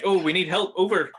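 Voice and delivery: loud, high mock panic call; panicked Marge. Marge calling the police on the radio, loud and high. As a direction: yell into the radio for help. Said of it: "she's like".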